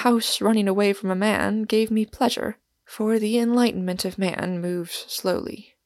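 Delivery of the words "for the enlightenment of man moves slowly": ordinary delivery, nothing marked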